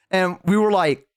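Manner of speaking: ordinary talk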